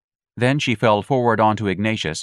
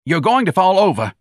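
snapping; 11 semitones higher, 6 dB louder than usual. A narrator's reading. Gonzalez continuing the snap.

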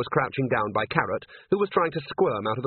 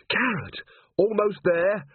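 calling out; 9 semitones higher, slower than usual. A narrator's reading. Angua calling up to Carrot in the fight, shouting. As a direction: call him to hurry. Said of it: "Angua calling out".

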